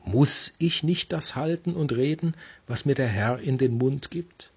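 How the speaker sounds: MUSS high and emphatic at the start; Betonung auf MUSS. Shown as a demonstration. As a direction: lean hard on the opening word MUSS. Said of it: "mit der Betonung auf dem Muss". (das erste Wort MUSS hoch und deutlich betonen)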